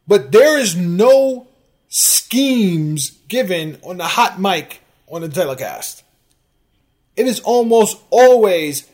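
loud, slow and emphatic; indignant rant. Real, not acted. Host correcting a fan on hot-mic broadcasts, indignant.

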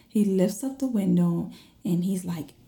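plain reading voice

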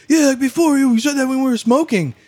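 high-pitched mock-excited yelling over music; excited over loud music. Stoned friend blurting out over loud music, mock excited. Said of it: "we were really excited, loud music".

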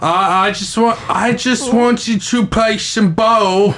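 high, drawn-out Australian impression. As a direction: plead slowly in a high put-on Australian voice.

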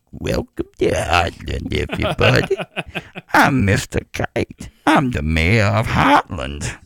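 put-on low, slow old mayor voice; drawn-out old showman welcome; Mister Kite again. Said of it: "the greatest little segment that ever was in Heartland".

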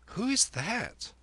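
put-on high, slow questioning boy; high-pitched curious youngster; boy Kim. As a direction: ask in a high boy's voice. Narrator voices young Kim.

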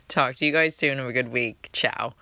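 usual speaking voice

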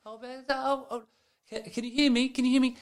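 high, soft, far-off woman's voice; faint speakerphone lady. Retold or imitated. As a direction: imitate a woman barely heard on a bad speakerphone. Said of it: "a lady that sounds like she's on speakerphone down a cliff".

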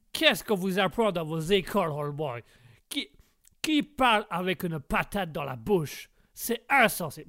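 outraged comic American speaking French; American accent. Acted. American character scolds the host about school, indignant American accent. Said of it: "les accents de Guégué".

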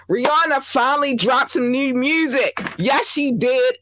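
high, excited headline shout-out; thrilled, high-pitched proclamation; excited announcement. Real, not acted.

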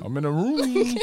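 high mock Baltimore room, said as rum; Baltimore accent. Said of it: "sound like some Baltimore shit".

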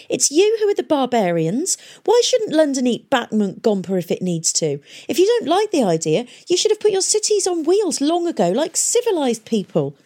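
high, loud indignant rant; angry tirade. A narrator's reading. Tom ranting at the League council, loud and high.

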